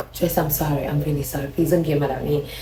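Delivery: soft, low pleading; begging softly. Retold or imitated. Girlfriend on her knees begging him, soft plea. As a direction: beg quietly with a hushed voice. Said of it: "she started to beg".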